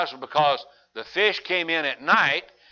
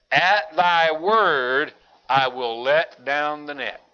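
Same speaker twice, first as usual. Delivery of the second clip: slow solemn words; much slower than usual. Acted.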